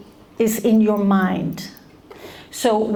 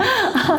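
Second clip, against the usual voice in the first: high put-on laugh; forced laugh; 10 semitones higher than usual. Shown as a demonstration. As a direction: fake a giggle for show. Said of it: "Now I'm gonna do my happy face".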